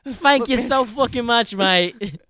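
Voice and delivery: loud, high hammed-up British accent; mock British accent. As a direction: gush thanks like a cartoon Brit. British Tom Holland bit.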